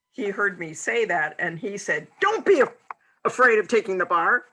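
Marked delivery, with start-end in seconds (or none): 2.2-4.5 much higher and louder, a squeaky mock of his advice; mocking the boyfriend. Mocking her boyfriend's useless advice, higher and louder. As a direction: send up his pep talk in a shrill, silly voice.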